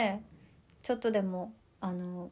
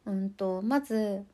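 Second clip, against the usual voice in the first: usual speaking voice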